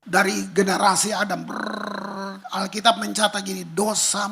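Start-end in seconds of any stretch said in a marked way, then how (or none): 1.4-2.5 long lip-trill whirr; rolling brrr sound. Shown as a demonstration. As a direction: trill a quick-forward noise through the generations. Imitated noise skipping through generations. (bunyi brrr bergetar panjang)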